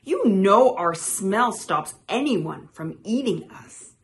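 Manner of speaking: loud, indignant defence; bawling. A narrator's reading. Toad defending toads' smell, loud and indignant. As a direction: protest loudly on behalf of toads. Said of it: "bawled Toad".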